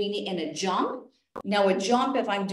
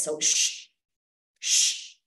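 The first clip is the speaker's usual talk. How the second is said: unvoiced shh exhale; breath hiss; 4 semitones lower, much slower than usual. Shown as a demonstration. Coach demonstrates the exhale, sharp shh hiss. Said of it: "I'll start by kind of doing this".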